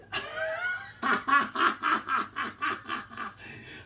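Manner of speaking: high burst of laughter; delighted laugh. Real, not acted. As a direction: laugh with relief and joy. Solves the puzzle, delighted.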